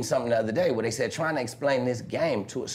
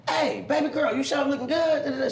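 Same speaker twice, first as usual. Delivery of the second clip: high, coaxing catcall; flirty call; 12 semitones higher than usual. Retold or imitated. Calling to her as she walks, high flirty charm. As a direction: lay on the charm from the car. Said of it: "So I'm like".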